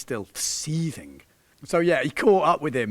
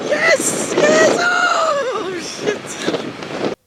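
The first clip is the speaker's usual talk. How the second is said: ecstatic shout; 7 dB louder, much slower than usual. Real, not acted. Cheering, then crash.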